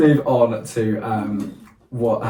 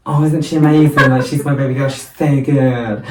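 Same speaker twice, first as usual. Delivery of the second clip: gushing proud mum, raised and loud at first; mock adoring-mother voice; doting mum; 3 semitones higher, 6 dB louder than usual. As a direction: coo over your baby girl, stretching the end. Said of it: "your mum's just there like".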